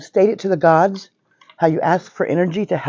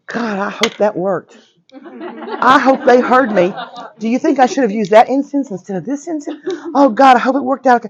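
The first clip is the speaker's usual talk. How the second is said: anxious fretting; 7 semitones higher than usual. Acted. Teacher mocking a student who doubts the spell, anxious. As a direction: fret like a doubtful beginner. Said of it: "you don't walk away going".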